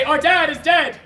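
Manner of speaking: loud, emphatic stage line; loud announcement. Acted. Narrator feeds king's children their line, loud declaiming. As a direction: declare it loudly for others to echo.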